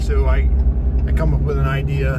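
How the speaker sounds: loud voice over workshop noise; shouting over machinery. Real, not acted. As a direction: raise your voice over the machines. Talking in a noisy workshop.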